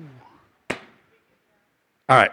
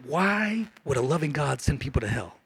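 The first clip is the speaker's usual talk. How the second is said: quiet skeptic's question; 6 semitones lower, 14 dB quieter than usual. Retold or imitated. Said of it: "When people ask me".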